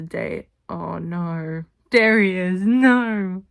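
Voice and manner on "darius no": 1 loud protest. Real, not acted. Scolding the character fondly.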